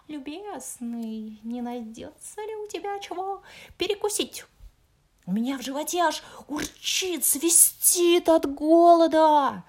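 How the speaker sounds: a hungry fox moaning for food. Acted. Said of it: "простонал лис". (стонущий лис)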